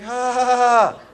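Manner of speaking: loud, high held nervous cry; acted startled wail; panicked "ha". Acts out his panicked response.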